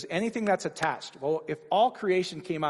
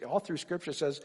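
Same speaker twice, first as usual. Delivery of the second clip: normal talking voice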